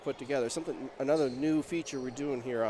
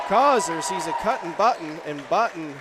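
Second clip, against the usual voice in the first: louder, punched-up basketball call; live play-by-play; 3 semitones higher, 6 dB louder than usual. Real, not acted. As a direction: call the play with energy, hitting the names.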